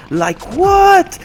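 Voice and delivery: high incredulous exclamation; surprised. Real, not acted. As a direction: squeak out your disbelief. Disbelief at the echo.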